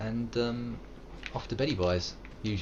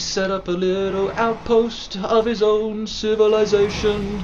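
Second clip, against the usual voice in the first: put-on Scottish accent; 10 semitones higher, 6 dB louder than usual. Narrating his game, in a high Scottish accent. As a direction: tell it in a Glaswegian lilt. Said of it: "Billy Connolly".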